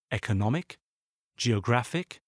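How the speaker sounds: "economic, geographic", each stressed just before the -ic; penultimate-syllable stress. Shown as a demonstration. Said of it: "sont accentués sur l'avant-dernière syllabe".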